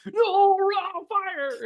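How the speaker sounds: high yelp of mock alarm. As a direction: cry out as if catching fire. Mock panic at fire.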